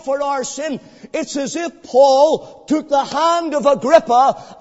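loud, slow pulpit preaching; preacher thundering about Calvary; preaching at full force. Acted. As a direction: drive the cross home to the congregation. Preaching the cross.

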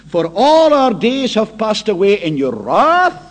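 loud, measured psalm reading from the pulpit; solemn scripture reading. Acted. Preacher reads the psalm of wrath to the congregation, solemn and loud. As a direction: proclaim the verses slowly and gravely.

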